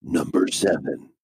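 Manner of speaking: low countdown announcer; deep announcer voice. Pretend. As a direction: boom out the number like a game show. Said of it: "the super deep voice".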